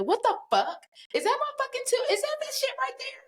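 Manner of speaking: stunned, high-pitched what the fuck; shocked disbelief. Retold or imitated. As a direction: react as if you can't believe your eyes. Shocked, seeing her tooth.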